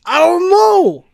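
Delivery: squeaky slow Cockney Chicago. Retold or imitated. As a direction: drag it out in a squeaky fake Cockney Chicago accent. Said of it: "That was Cockney Chicago".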